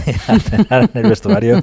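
real laugh breaking through the words; laughing while talking. Real, not acted. Presenter recalling players' kebab dinner in changing room, laughing. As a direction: let a chuckle overtake the sentence.

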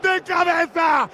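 stretched, high shouted commentary; frenzied goal call. Real, not acted. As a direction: roar it, stretched out.

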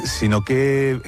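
normal talking voice

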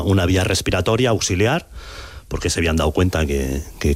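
ordinary talk, nothing marked

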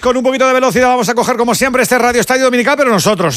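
high, driving radio-host opening; arranque de locutor. Acted. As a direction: open the sports show at full announcer pitch. Presentador abre el programa. (arranque de locutor deportivo, agudo y enérgico)

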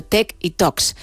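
"Talks" said with no L sound, like "toks"; silent L. Shown as a demonstration. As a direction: say "Talks" near-native, leaving the L silent. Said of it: "no se pronuncia la L".

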